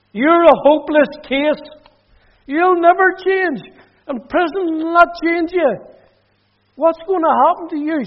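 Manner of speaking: mother at the cell door, despairing outcry. Retold or imitated. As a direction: fling it at him from the doorway, voice way up. Mother's parting words at door.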